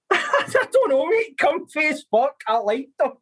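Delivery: high-pitched, talking through laughter; real laughing. Real, not acted. Stumped guest laughs over his one-word answer, laughing helplessly. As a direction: crack up while trying to answer.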